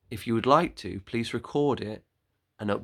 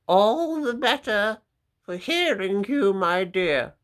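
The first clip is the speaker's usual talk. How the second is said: high, louder put-on granny voice; sly wolf granny; 10 semitones higher, 7 dB louder than usual. Wolf in disguise answers the girl, high louder fake granny voice. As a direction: answer sweetly with a sly edge.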